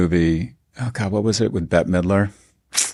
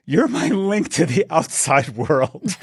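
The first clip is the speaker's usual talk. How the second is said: slow, panicked plea in a put-on voice; 5 semitones higher, slower than usual. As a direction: plead slowly like a panicked, clinging lover. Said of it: "he's panicked and he's upset".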